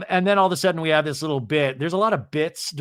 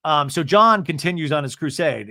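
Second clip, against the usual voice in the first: ordinary talk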